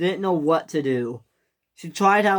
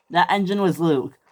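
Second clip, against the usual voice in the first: ordinary delivery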